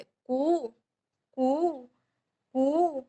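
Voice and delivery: each "कु" drawn out as koo; unhurried, stretched oo in each "कु"; long oo sound. Shown as a demonstration. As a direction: hold the vowel of every "कु" long, pausing between. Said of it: "ये आराम से बोल जाते".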